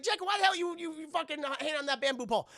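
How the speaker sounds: high, loud baffled demand; hammed-up disbelief at a friend; mock incredulous. Voices Tucker's startled question.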